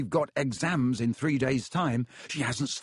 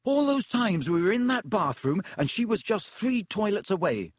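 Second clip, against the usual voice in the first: bitter; 8 semitones higher than usual. A narrator's reading. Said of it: "said Ron bitterly".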